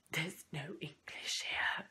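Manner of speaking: slow horrified whisper; whispering in dismay after the dad greets her in French. Retold or imitated. Shock at hearing French.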